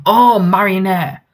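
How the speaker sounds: drawn-out, high exclamation; slow, high burst of realisation; excited recognition. Real, not acted. Reader spots a familiar character name, excited recognition.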